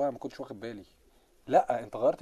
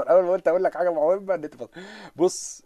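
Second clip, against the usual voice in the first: normal talking voice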